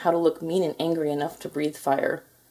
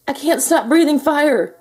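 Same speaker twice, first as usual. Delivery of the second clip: panicked; 9 semitones higher, 6 dB louder than usual. A narrator's reading. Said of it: "Crispin's father panicked".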